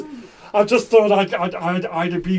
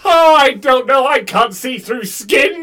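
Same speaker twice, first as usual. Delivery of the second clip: loud, raised-pitch protest; panicked shout; 5 semitones higher, 7 dB louder than usual. Acted. Asked to check his veins, panicked shout. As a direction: shout it in rising alarm.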